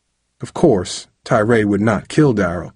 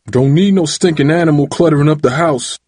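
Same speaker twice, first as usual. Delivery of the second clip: raised, louder, gruff complaint; growled anger; 6 semitones higher, 5 dB louder than usual. A narrator's reading. Father throws out the stray puppy, angry growl. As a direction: growl it with contempt. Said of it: "Dad had growled".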